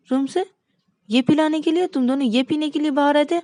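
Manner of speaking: high, loud outraged yelling; shocked wife shrieking at the two drinkers; furious shouting. Acted. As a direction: scold them at the top of your voice. Wife finds them drinking alcohol.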